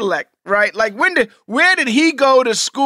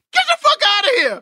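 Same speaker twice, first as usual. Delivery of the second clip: incredulous outburst; 14 semitones higher, faster than usual. Real, not acted. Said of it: "reacts in disbelief".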